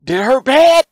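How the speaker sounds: little brother gloating over the whooping; gleeful little brother. Retold or imitated. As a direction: rub it in with delight. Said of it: "And Dorian".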